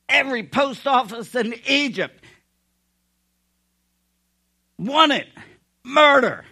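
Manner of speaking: thundering preacher, high and very loud; God. Acted. God listing mighty deeds to doubters, thundering. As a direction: build to a full-force proclamation, phrase by phrase. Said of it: "That's what God says".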